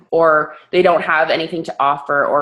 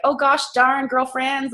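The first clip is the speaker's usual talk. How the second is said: higher-pitched, put-on goody-goody voice; mock wholesome talk; 7 semitones higher than usual. Sweary coach mocking how she won't talk, put-on sweetness. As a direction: imitate squeaky-clean girlfriend chat. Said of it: "I'm not gonna be like".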